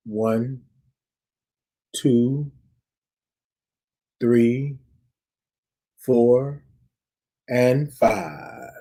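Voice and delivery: slow soft count to five, long pauses; slow breath count. Shown as a demonstration. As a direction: count the breath out slowly and softly. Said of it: "I'm gonna count it out".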